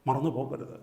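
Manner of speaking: soft, low admonition; hushed reminder. Real, not acted. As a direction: drop right down to caution the congregation. Hushed reminder after loud preaching.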